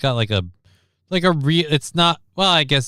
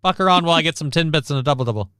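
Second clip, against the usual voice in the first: fast, higher mock Canadian accent; 8 semitones higher, faster than usual. Mocking Canadians about the Canadarm in Canadian accent. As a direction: rattle it off like a teasing Canadian hoser. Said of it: "'cause I was doing that accent".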